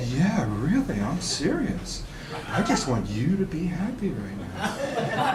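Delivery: soft, slow, swooping croon; tender reassurance. Retold or imitated. Comic retelling himself blissfully reassuring his girlfriend, tender. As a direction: gush softly with mock-dreamy sincerity.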